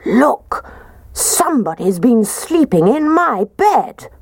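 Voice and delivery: big bear voice pushed up high; Daddy Bear. A narrator's reading. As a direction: exclaim it as an outraged father bear. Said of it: "when Daddy Bear said".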